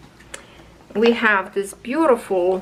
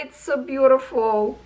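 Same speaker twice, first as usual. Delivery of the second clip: moved; 3 semitones higher than usual. Real, not acted. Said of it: "I cannot hold myself".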